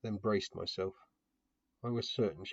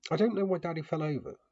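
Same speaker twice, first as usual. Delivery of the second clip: put-on small girl voice; 8 semitones higher, 5 dB louder than usual. A narrator's reading. Child voice in story.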